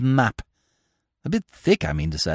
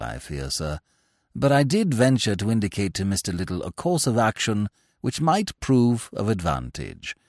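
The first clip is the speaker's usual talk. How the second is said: low, measured manservant's report; lightly voiced lower valet; Jeeves the valet; 8 semitones lower, 5 dB quieter than usual. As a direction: report it like an unflappable butler. Jeeves advises Bertie.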